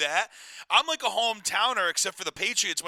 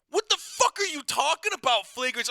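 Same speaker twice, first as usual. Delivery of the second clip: retold outrage; 8 semitones higher than usual. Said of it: "And I'm like".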